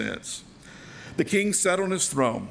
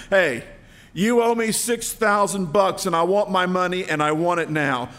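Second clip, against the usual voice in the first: loud, higher greedy demand; 3 semitones higher, 5 dB louder than usual. Acted. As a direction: grab him by the throat and bark for the money. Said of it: "said in a greedy tone".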